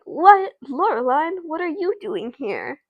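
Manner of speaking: startled, high-pitched acted surprise; surprised. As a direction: jump up high on the what, taken aback. Student startled by friend walking in.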